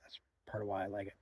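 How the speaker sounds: hushed unvoiced whisper. Real, not acted. Hunter on camera describing the quiet spot, in a whisper. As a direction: drop to a whisper as if not scaring deer. Talks about trying to be quiet.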